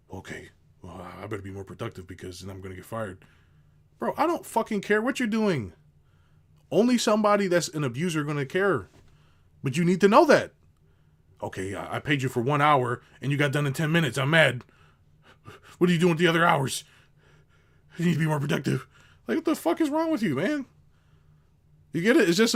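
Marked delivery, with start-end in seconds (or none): 0.1-3.2 lower, much softer, timid worker voice; mocking a timid worker, low and soft; meek worker. Worker answering boss. 11.4-18.9 louder, scolding boss voice; a hint of an angry boss, somewhat louder; mock angry boss.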